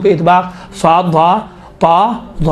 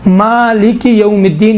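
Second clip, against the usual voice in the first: slow, drawn-out "مالک یوم الدین"; tartil recitation; slower than usual. Shown as a demonstration. Teacher modelling verse reading for students, slow tartil. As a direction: lengthen "Malik" and "Uddin" in measured chant. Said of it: "ترتیل کے صورت میں پڑھیں".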